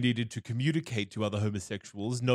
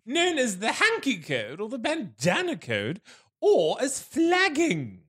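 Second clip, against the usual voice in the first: Marquis du Taint voice; 8 semitones higher than usual. Pretend. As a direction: keep the silly marquis voice, much higher, while explaining. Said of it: "so now it's gone".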